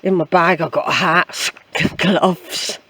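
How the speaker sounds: usual speaking voice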